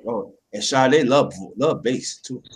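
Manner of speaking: ordinary delivery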